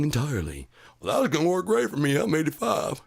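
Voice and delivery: put-on high, fast creaky old-timer; old man cheering news of endless life; mock old man. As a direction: play a thrilled eighty-five-year-old. Mocking old man reacting to news.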